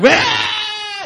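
high mock baby wail; fake baby cry. Dad imitating his son's fake wail. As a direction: squeal like a toddler fake-crying. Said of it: "it's fake crying".

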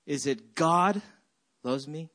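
weight on "God", pause, trail into a question; stress on GOD. Shown as a demonstration. Pastor asking congregation their point of emphasis, stress on "God". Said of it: "what the point of emphasis".

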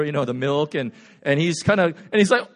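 normal talking voice

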